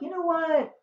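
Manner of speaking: Beatrice's retort. Retold or imitated. Beatrice defending Hero, high mock indignation. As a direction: pitch it up as a bold cousin. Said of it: "Beatrice is like".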